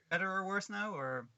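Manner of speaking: metallic voice filter sound; tinny voice. Real, not acted. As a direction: voice like in a tin can. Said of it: "exactly the same".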